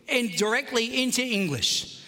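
usual speaking voice

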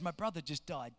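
low, soft, grieving report; downcast. Retold or imitated. Gas attendant telling the pastor of his loss, downcast. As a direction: imitate quiet grief, dropping low. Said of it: "I could tell he was down".

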